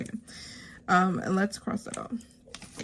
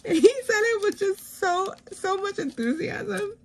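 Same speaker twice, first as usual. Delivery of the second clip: amused; 14 semitones higher than usual. Real, not acted. Still amused retelling son's reaction.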